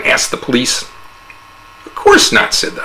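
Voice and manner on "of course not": very high breathy feigned innocence; innocent denial. A narrator's reading. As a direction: play dumb sweetly.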